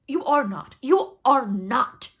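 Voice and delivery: high, very loud denial; hoarse cry. A narrator's reading. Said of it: "Hook cried hoarsely".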